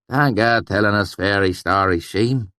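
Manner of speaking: a touch of mock disbelief; teasing the swineherd. A narrator's reading. Narrator goading Sheem, lightly scoffing. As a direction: needle him to get him talking.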